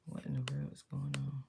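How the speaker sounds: hushed, near-whispered mutter to self; real puzzled whisper to yourself; muttered aside. Real, not acted. Puzzled aside while software stalls.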